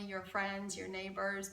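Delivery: plain reading voice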